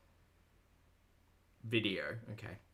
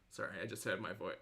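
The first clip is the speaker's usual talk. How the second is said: usual speaking voice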